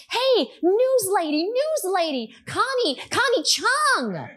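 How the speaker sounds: high-pitched, drawn-out hail to a stranger; calling out. Retold or imitated. Someone spots the reporter in public, calling out. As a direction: imitate an excited passer-by calling across a street.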